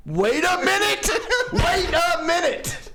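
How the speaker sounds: laughing through the words, high. Real, not acted. Laughing at the imagined commenter, high and laughing. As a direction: crack up as you say it.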